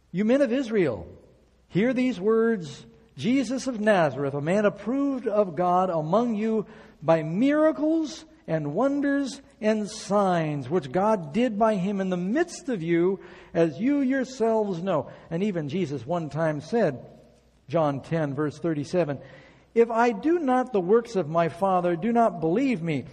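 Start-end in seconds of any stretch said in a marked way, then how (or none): 0.1-15.1 higher, louder, slow proclamation; forceful scripture preaching. Acted. Preacher reading Peter's sermon to the congregation, in a loud, slow proclamation. 19.7-23.0 forceful scripture preaching. Acted. Reads Jesus' words at full force.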